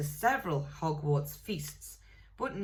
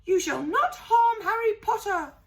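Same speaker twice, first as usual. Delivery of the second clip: high, loud squeaky elf voice; a house-elf shrieking in defence; shrill elf cry; 7 dB louder than usual. A narrator's reading. Elf Dobby shouting protectively.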